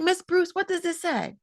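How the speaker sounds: high, lightly voiced child asking teacher; a hint of a puzzled little boy.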